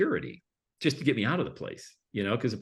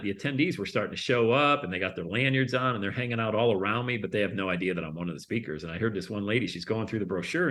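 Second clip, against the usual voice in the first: normal talking voice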